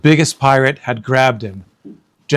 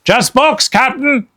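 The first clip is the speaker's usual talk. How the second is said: Stinky shouting from the treehouse; shouting pirate; 13 semitones higher than usual. Acted. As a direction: call it down. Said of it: "shouted Stinky".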